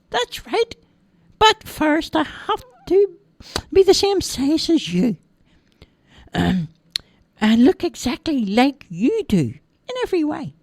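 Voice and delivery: very high squeaky little voice; chirpy tiny elf; tiny man voice. Acted. Tiny man greets crying Roger, squeaky high.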